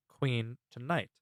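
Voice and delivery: usual speaking voice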